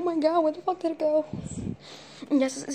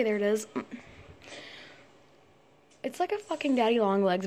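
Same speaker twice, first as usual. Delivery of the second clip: normal talking voice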